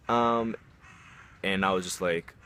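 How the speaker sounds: ordinary delivery, nothing marked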